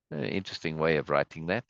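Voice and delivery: ordinary talk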